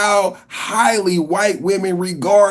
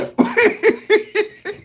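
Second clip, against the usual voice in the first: high cackle. Real, not acted. Host cracking up at chat, high cackle. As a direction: burst into a shrill cackle.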